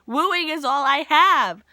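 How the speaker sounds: high, loud playful lament; hammed-up frustration at herself; dramatic dismay. Real, not acted. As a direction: wail it out in mock despair. Laments her failed attempts at wooing.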